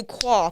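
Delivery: "Qua" said with an open ah ending; rejected pronunciation. Shown as a demonstration. Trying out a guest's name, "Qua" with an ah sound. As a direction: say "Qua" to rhyme with "spa". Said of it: "that sounds like female".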